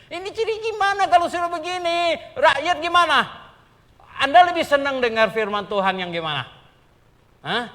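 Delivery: loud, high challenge to the crowd; rising pulpit challenge; preaching at full force. Acted. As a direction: press the congregation with the question.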